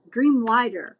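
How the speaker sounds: ordinary talk, nothing marked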